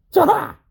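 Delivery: a high, loud triumphant ta-ha; gloating ta-ha. Real, not acted. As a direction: crow over the rival saws. Gloating laugh-cry after boasting.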